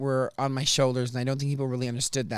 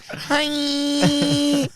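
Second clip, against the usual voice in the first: high, bright-pitched squeaky hi; LA valley girl hi; 12 semitones higher, 5 dB louder than usual. Shown as a demonstration. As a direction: play up the breathy LA greeting. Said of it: "I'm gonna end up talking like this".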